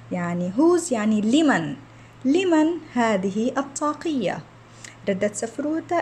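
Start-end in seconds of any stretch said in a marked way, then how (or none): none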